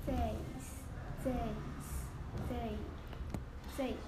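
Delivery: "days" repeated very slowly and softly; slow drilled days. Shown as a demonstration. Student practising "days" slowly for the tutor. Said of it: "你大声一点".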